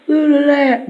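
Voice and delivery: high, loud squeak; cartoon jeer. Turd and Fart mocking the monkey, high and loud. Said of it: "they're like".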